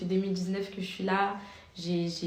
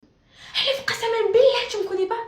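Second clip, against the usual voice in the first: aggressive stranger; 9 dB louder than usual. Retold or imitated. Vlogger voicing the angry woman in the street, high and loud. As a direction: ham up the angry accuser.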